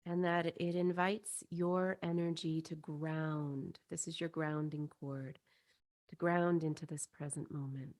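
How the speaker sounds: soothing guided-visualization voice, spacious phrasing; guided meditation. Acted. Leads a chakra meditation.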